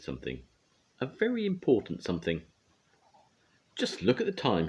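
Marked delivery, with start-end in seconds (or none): 3.8-4.7 hasty excuse. A narrator's reading. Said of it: "he cried".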